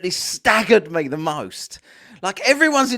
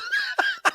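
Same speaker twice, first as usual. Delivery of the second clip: laughing; 5 dB quieter than usual. Real, not acted. Reacting to the clip.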